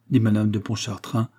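ordinary delivery, nothing marked

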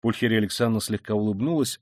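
usual speaking voice

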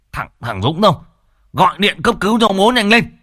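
shouting orders. A narrator's reading. Father shouting for someone to call an ambulance, alarmed yell. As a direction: bark an urgent order. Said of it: "lão Sửu vẫn quát lớn".

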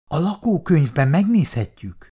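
volunteer it officiously; eagerly helpful. A narrator's reading. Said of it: "készségeskedett Péteri". (készségeskedve)

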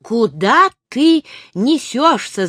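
slow, stretched cry of alarm; alarmed shout. A narrator's reading. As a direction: protest in fright, drawing out the words. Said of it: "закричал он".

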